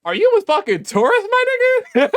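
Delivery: high, loud disbelieving question; incredulous. Real, not acted. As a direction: blurt it out in stunned disbelief. Incredulous reply to a commenter.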